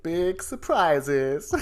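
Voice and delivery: slow, drawn-out show catchphrase; John Campea show catchphrase. Retold or imitated. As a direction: stretch it out like a hammed-up catchphrase. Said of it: "as they say on the John Campea show".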